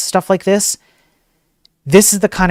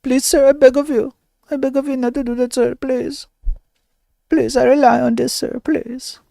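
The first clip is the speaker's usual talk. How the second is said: pleading hacker; 8 semitones higher than usual. Pretend. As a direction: plead in a high thin whimper. Hacker begging again.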